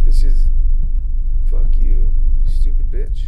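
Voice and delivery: loud cursing at the monster; angry yell. Real, not acted. Gamer cursing the monster, angry yell. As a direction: snap it out furiously.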